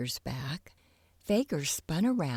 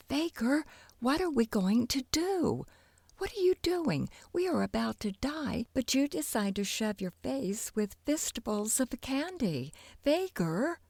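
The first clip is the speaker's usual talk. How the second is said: high, frantic panic; a girl pitched high in mock panic; panicked; 8 semitones higher than usual. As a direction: plead in alarm, voice jumping up. Trapped in kettle, panicking at Faker.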